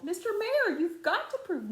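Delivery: high calls across a distance; calling out. A narrator's reading. Horton calls down to the mayor on the speck. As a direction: call up to the tiny mayor. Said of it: "Horton called".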